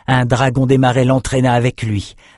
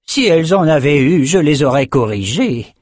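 sneering; 5 semitones higher, 4 dB louder than usual. A narrator's reading. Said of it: "Le sorcier de chair ricana".

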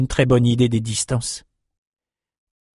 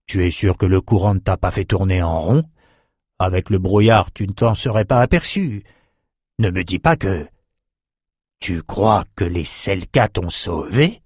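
put-on old sorcerer voice; 3 semitones lower than usual. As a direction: speak as a kindly old mage.

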